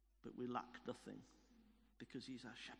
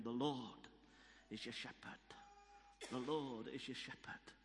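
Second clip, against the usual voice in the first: lower, restrained Welsh voice; Welsh accent; 4 semitones lower, 5 dB quieter than usual. Retold or imitated. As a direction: echo a remembered Welsh voice in your ear. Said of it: "that Welsh voice in my ear".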